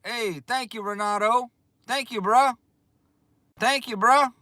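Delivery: high, bright gratitude; real excited thank you. Real, not acted. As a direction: thank him up in the air.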